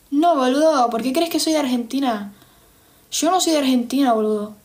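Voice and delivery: imitated rioplatense Spanish; Argentine accent. Denying she is from Argentina, in Argentine accent. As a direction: put on a playful Argentine accent. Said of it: "No, no soy de Argentina".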